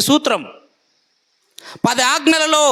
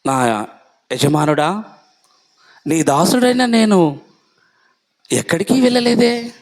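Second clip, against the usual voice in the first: servant's lie; 5 semitones lower than usual. Acted. Master questions his servant.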